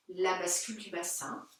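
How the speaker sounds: usual speaking voice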